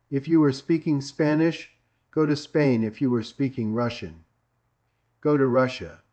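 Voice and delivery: ordinary delivery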